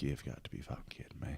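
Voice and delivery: hushed, breathy whisper; a whispered groan at the seat; whispered disbelief. Retold or imitated. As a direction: whisper it so he cannot hear. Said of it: "I'm like".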